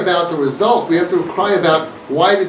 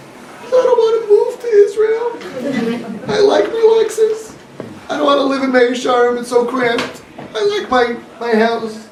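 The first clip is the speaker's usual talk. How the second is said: whiny wife voice; 8 semitones higher than usual. Retold or imitated. Wife whining to her husband at the Seder, much higher. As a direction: pitch way up and whine like a spoiled wife.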